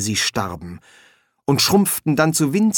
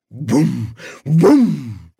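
two humming booms, second louder; imitated booms; 4 dB louder than usual. A narrator's reading. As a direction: boom twice, building. Sound of the pot firing.

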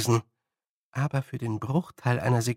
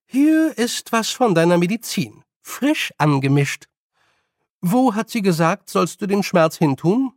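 higher, raised bedside voice; brisk old witch, caring; 5 semitones higher, 4 dB louder than usual. Acted. Nanny hands old man his medicine, raised voice. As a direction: speak up to an old patient.

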